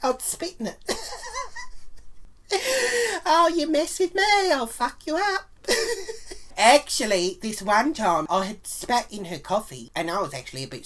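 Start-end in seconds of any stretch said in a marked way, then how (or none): 0.8-3.2 high, delighted wicked cackle. Real, not acted. Confessing the spit to viewers, high wicked cackle. Gleeful about spitting in coffee. 3.2-5.5 high, loud gloating through laughter; laughing threat. Real, not acted. Gloating to viewers about revenge, laughing through it. 5.7-6.5 short high giggle; high cackle. Real, not acted. Wrapping up the revenge boast with a high giggle.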